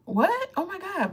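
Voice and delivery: high squeal of disbelief; mock surprise. Retelling her reaction to kindness, high surprised squeal. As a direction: jump way up in pitch, amazed. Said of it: "people being kind to me is always like".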